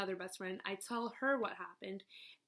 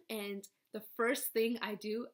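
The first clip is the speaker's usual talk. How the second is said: normal talking voice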